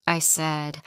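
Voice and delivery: ordinary delivery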